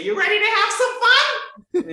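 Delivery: high, loud, excited call; playful invitation. Pretend. Coach asking her own voice to play, high excited call.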